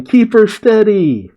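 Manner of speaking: high, drawn-out call to hold steady; retold yell. Otama's crowd shouting to the lantern boats, high yell. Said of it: "You got Otama yelling".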